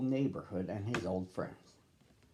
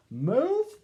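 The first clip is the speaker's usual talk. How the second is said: high, loud startled "Move?"; a cub's shocked squeak; surprised cry; 7 dB louder than usual. Acted. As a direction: shoot up in alarm on "Move?". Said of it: "cried Brother Bear".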